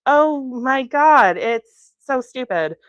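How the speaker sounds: slow, overdone breezy brush-off; too casual. Acted. Kat covering her injury, overly casual and slow. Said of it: "Kat's voice became a little too casual".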